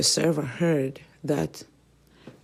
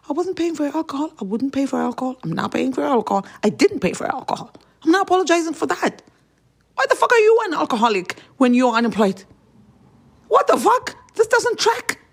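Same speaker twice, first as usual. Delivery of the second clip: much higher and louder, almost shrieking; furious rant; 9 semitones higher, 4 dB louder than usual. Real, not acted. Telling the ex off over the alcohol, furious and loud. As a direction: blow up in disbelief.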